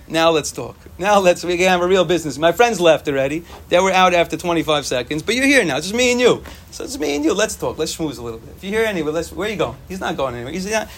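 ordinary delivery

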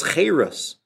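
cheres with an ay vowel, drawn out; cheres, changed vowel. Shown as a demonstration. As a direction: stretch cheres, first vowel as ay. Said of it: "you just change the vowelation".

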